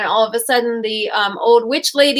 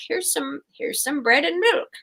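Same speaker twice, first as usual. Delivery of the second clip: high, sugary witch luring guests in; a lightly voiced welcoming witch; sweet witch voice; 5 semitones higher than usual.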